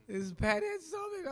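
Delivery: slow, high, loud words full of awe; awestruck. Retold or imitated. Coach recalling her idol sitting down, awestruck and slow. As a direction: say her name like you can't believe it. Starstruck seeing Pat Summitt.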